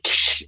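a short hissed tsh like a radio keying on; radio click. Retold or imitated. Retelling the radio clicking on in the change room, mimicked static hiss. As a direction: imitate the crackle of a radio clicking on. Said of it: "click of the radio".

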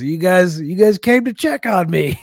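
high, slow, loopy dad imitation; looped up on painkillers. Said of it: "he was all looped up on pain pills".

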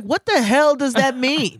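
slow, high, incredulous retort; indignant comeback. Retold or imitated. Quoting a comeback to the girl who insulted her, indignant. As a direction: draw it out in retold outrage. Quotes own angry comeback.

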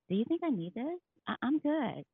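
mock offended surprise; incredulous high quote. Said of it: "your first thought is".

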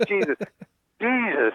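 high strained cry of the name, with a laugh; straining in fear. Retold or imitated. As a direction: force the name out through fear. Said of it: "He could hardly say Jesus".

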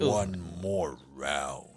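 deep, very slow ghostly announcer; voice from beyond the grave. Pretend. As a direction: drag it out low like a spectral MC. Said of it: "tiene que ser una voz".

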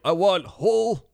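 put-on an old man ordering bread too precisely; hammed-up particular old customer; fussy old man. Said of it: "the guy's like".

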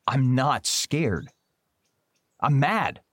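drawn-out, huffy indignation; indignant denial. Acted. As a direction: insist on it, stung. Indignant denial of being scared.

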